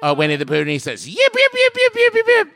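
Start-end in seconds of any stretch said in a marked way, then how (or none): 1.1-2.5 high yapping fox yips; yappy chihuahua voice. Acted. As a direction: yip like a tiny excited dog.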